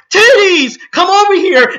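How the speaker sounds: high, loud cartoon yell; Goku. Cartoon hero calling his wife over, high mock yell. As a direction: shout it like a TV cartoon blaring. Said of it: "Goku yelling out".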